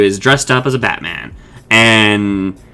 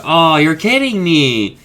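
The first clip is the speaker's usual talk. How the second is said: drawn-out, higher-pitched mock yell; 6 semitones higher, slower than usual. Retelling Dean's outburst over aftershave, mock yell. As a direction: imitate someone crying out, slow and high. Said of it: "he yells out".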